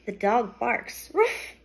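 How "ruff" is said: high playful woof; dog bark. Acted. Teacher barking for the class. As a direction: bark it out like a puppy. Teacher reads animal sound aloud.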